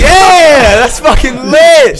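loud high yell, stretched slow; whooping, slowed hype yell; hype shout. Real, not acted. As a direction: shout it out like a party cheer. Cheering a friend's brag.

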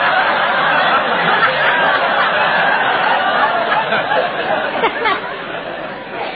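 long airy laugh; breathy laughter. Real, not acted. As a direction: laugh helplessly at the joke. Laughing at dog joke.

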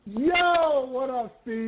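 excited greeting. Real, not acted. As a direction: hype up the caller with a big greeting. Host greets caller loudly.

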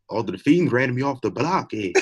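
a lightly put-on Irish accent, drifting Scottish; mock Irish accent that slides Scottish; bad Irish accent. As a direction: try an Irish brogue and miss. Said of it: "I can't do a good Irish accent, but I'ma try".

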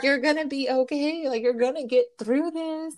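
high-pitched, strained reassurance, retold; mock holding back tears while comforting; trying to stay strong. Comforting a friend at goodbye, acted trying to stay strong. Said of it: "trying to stay strong".

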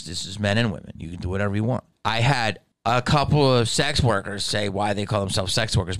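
ordinary delivery

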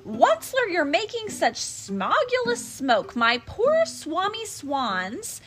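high, loud Lorax voice. Acted. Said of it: "he cried with a cruffulous croak".

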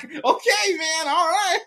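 squeaky laugh through words; high laughing okay. Retold or imitated. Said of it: "and I'm like".

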